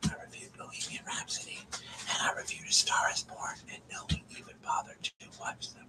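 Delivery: hushed, voiceless ASMR delivery; whisper. Shown as a demonstration. Streamer says nobody watched the reviews, whispering. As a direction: keep it to a soft whisper. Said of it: "We'll just whisper like this to you".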